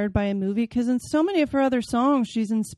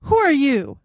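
startled question; 4 semitones higher, 7 dB louder than usual. Acted. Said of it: "I asked".

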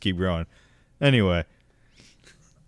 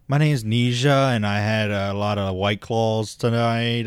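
put-on slow, slurry tipsy college girl; drunk college girl; slower than usual. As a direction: drag the words out like a drunk student. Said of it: "sounds like a, a fucking college girl".

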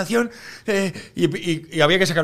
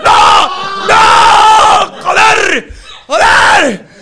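high, loud screams of no and joder; retold meltdown, shouted; desperate shouting; 13 semitones higher, 11 dB louder, much slower than usual. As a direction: yell it out in panic. No toilet at the station.

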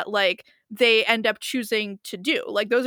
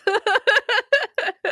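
high loud burst of laughter; 13 semitones higher, 6 dB louder than usual. Real, not acted. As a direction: laugh hard at your own joke. Laughs at own joke.

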